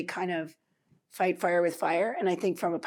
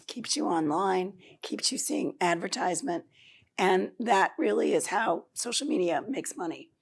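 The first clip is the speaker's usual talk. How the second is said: normal talking voice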